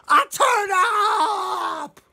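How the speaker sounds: high, loud, long-drawn shout; really excited. Acted. Storyteller voicing Duck yelling his idea, wildly excited. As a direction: holler it, stretching the word out. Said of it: "He's really excited".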